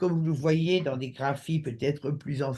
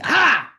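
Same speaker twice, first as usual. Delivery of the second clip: loud high cry of ah; shouted ah; 8 semitones higher, 9 dB louder than usual. Shown as a demonstration. Teacher shows a sound with no word, loud cry. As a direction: let out a sharp yelled ah. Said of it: "ou bien si je dis".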